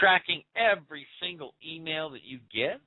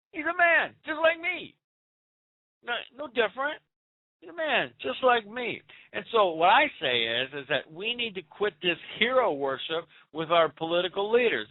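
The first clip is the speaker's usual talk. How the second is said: normal talking voice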